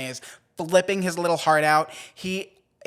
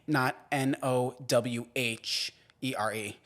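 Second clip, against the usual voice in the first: ordinary talk, nothing marked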